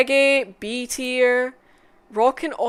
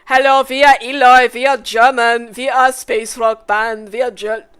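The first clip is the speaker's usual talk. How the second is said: loud mock German introduction; German accent; 9 dB louder than usual. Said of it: "Eloy, you're German".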